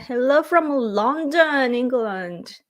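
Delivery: a hammed-up British accent, drawn out slow; mock British accent. Playing the London video greeting in a mock British accent. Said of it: "I can't do the, uh, accent".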